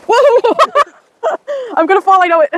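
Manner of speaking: high scared yelp, nervous laugh; screaming while falling. Real, not acted. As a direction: panic as the feet slip. Nearly falling down slope.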